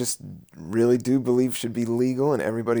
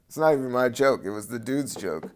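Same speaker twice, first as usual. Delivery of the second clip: usual speaking voice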